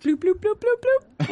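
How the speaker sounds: high-pitched cartoon bubble pops; imitated bubble bloops. Co-host teasing about heart bubbles, high playful bloops.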